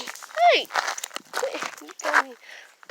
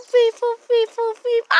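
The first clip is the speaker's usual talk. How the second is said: high, loud playful chant; excited cheer; 7 semitones higher, 9 dB louder than usual. Real, not acted. Playing with her cat, an excited high cheer.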